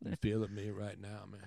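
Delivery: very soft, low-pitched talk; hushed, low question to a buddy; low-level enunciation. Shown as a demonstration. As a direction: keep it down in energy-saver mode. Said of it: "enunciating at a different level".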